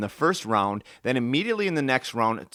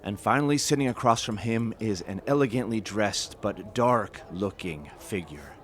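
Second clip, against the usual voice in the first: lower, softer storyteller voice setting a tavern scene; story narration; 3 semitones lower, 5 dB quieter than usual. A narrator's reading. Narrator opening the adventure in the tavern, storytelling voice. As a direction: draw listeners into the tale, unhurried.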